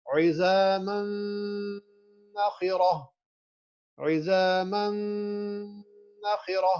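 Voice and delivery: عظاماً held nasal, نخرہ plain; slow demo of the nasal merge; nasal ghunnah. Shown as a demonstration. Teacher modelling the sound for the class. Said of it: "sound دیکھیں کیسے create ہو رہی ہے".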